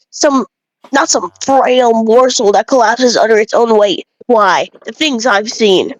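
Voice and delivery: a lightly voiced village know-it-all, preachy about grain; bread-obsessed villager. Lightly voiced villager lecturing the player about bread, smug. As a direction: play a self-important bread snob lecturing a traveler.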